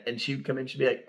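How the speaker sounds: normal talking voice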